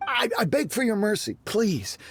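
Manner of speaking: high, desperate plea; begging for mercy. Acted. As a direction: beg as if your job depends on it.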